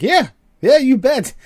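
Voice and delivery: high and loud, cocky agreement; defiant dad. Retold or imitated. Father boasting to his boss, loud high defiance. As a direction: brag back defiantly to the boss. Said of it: "He's like".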